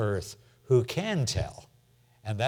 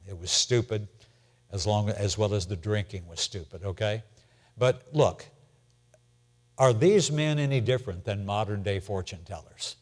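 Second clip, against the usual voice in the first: normal talking voice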